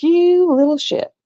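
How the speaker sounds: long drawn-out, high-pitched "you"; slow, swooping mock telling-off; mock scolding. Owner to her escape-artist dog, mock scolding.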